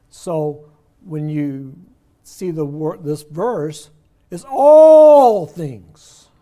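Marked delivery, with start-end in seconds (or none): 4.3-6.3 all things drawn out very slowly, much higher and much louder; stress on ALL THINGS. Shown as a demonstration. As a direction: hammer all things home to the class. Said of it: "It's emphasized because that's the first word".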